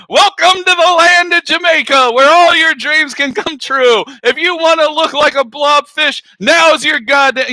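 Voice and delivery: high, booming welcome pitch; hammed-up promo host. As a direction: sell it like an over-the-top tourism ad. Acting out a planned Jamaica advert.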